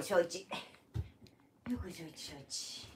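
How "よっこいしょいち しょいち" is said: よっこいしょいち trailing off into a soft, breathy whisper; fading quiet repeat. Shown as a demonstration. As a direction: let よっこいしょいち fade out to almost nothing. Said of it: "よっこいしょいち流行らんで".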